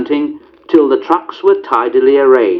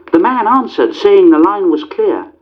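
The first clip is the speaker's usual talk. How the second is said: plain reading voice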